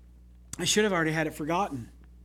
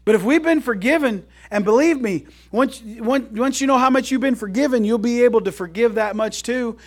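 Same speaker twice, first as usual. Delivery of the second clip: louder, higher, driving the point home; full-force preaching; 6 semitones higher, 7 dB louder than usual. Acted. Pastor urging the congregation to forgive, preaching loud. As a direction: preach it out to the whole room.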